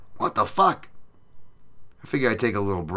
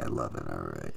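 normal talking voice

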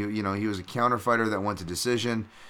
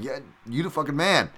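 higher, pumped-up hype, retold; 7 semitones higher than usual. Fan hyping up a modest fighter, pumped up. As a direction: insist on the praise like an eager fan. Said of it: "really pumped".

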